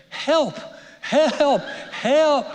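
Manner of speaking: high, loud panicked screams; screaming for help. Acted. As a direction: scream it like a man facing a lion. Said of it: "He starts screaming".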